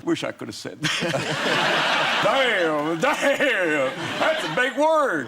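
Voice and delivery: high, slow, loud hammed-up Texan drawl; George W. Bush impression. W reacting to Blair's speech, high and slow. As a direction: ham up Bush straining at a big word. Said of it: "you see W going".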